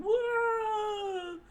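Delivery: high startled whoa; crew scream. Acted. Crew screaming as the ship lurches, high. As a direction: yell as the ship rocks. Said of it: "The loud scream of the crew".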